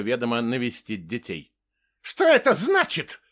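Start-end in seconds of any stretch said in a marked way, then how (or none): 2.0-3.2 very high, loud squeal of outrage; indignant shriek. A narrator's reading. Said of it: "завизжала Ингрид". (возмущённый визг)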